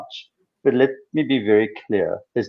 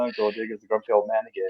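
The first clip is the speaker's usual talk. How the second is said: softer, quicker self-mocking aside; inner thought, muttered; 3 semitones lower, 8 dB quieter, faster than usual. Man mocking himself in his head, soft quick aside. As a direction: mutter it to yourself. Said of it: "I'm thinking in the back of my head".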